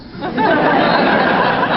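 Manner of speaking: high breathy giggle; short amused laugh. Real, not acted. Laughs at own story.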